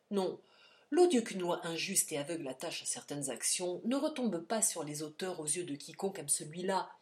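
ordinary delivery, nothing marked

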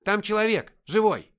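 loud, high-pitched private's report; громкий доклад. A narrator's reading. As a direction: report it loudly to the sergeant. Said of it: "громко доложил". (громко докладывает рядовой)

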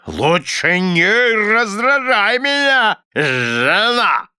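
high, slow, blustering threat; threatening. A narrator's reading. Husband warning his wife, threatening. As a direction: warn her off, dragging out the words. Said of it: "пригрозил мистер Корнер".

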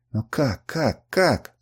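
stammered repeated question; halting, agitated stutter. A narrator's reading. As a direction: stumble over the word, repeating it. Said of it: "Она вдруг стала заикаться".

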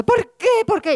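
high, sobbing question; crying. Acted. Truth found lying there crying, high sob. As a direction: cry out the why through tears. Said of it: "allí llorando".

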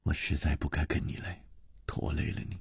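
soft, low, tearful regret. A narrator's reading. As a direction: blame yourself quietly through tears. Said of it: "眼中流下了泪".

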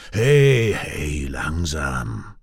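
drawn-out, slowing and dropping low; calming intervention. A narrator's reading. Vahan stepping between the quarrelling brothers, calming. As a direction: step in and slow them down. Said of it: "Vahan geht dazwischen".

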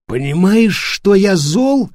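put-on drunk slurring, slow; slurred speech. A narrator's reading. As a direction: slur the words drunkenly. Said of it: "переспросил он заплетающимся языком". (заплетающимся языком)

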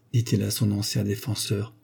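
ordinary delivery, nothing marked

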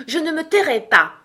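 high, loud defiance; defiant retort. A narrator's reading. Alice refuses the queen's order, defiant. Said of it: "répliqua Alice".

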